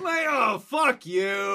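mock angry. Retort to British.

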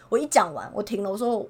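ordinary talk

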